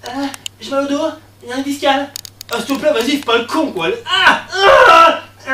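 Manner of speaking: high, loud fake suffering; exaggerated back pain. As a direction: plead and howl with put-on pain. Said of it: "pour exagérer les choses".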